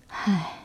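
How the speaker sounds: soft low weary sigh; old man sigh. Acted. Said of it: "老裁缝感慨着".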